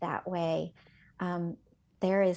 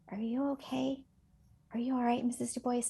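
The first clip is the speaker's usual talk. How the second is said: lightly voiced child, soft and higher; a small, gentle higher voice checking in; concerned child; 4 semitones higher, 3 dB quieter than usual. Pupil checking on her teacher, softly concerned child.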